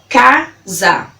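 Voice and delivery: CASA slowly split into ca - za; syllable by syllable. Shown as a demonstration. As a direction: break CASA into two separate beats, ca, za. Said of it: "falando elas pausadamente pra você".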